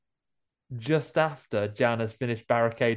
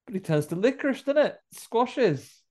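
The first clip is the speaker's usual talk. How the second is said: excited; 11 semitones higher, 3 dB louder than usual. Real, not acted. Excited retelling of gory moment.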